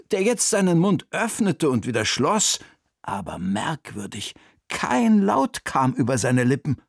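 usual speaking voice

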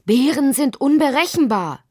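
aufgeregt warnender Vater, hoch; brüllend. A narrator's reading. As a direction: warne erregt mit erhobener Stimme. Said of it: "brüllte Lottis Vater". (alarmiert gerufen)

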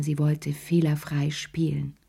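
usual speaking voice

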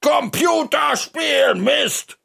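high, slow yell; drawn-out shout. Acted. Narrator joins the screaming, high drawn-out shout. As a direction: yell it, stretching the words. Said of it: "schreie ich".

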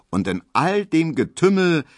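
ordinary delivery, nothing marked

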